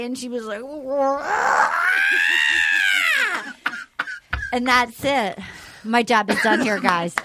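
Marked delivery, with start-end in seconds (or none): 0.6-4.5 high rising wail; wailing laugh-cry. Retold or imitated. The weeping mom wailing at the end. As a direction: wail out in despair. Mimicking Barb's final wail.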